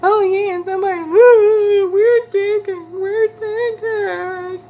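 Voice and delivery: high, wailing mock sob; husband mocking crying. Husband teasing his wife about her crying, in a high mock wail. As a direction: ham up fake crying to tease her. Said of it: "He was like".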